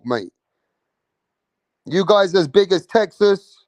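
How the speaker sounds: usual speaking voice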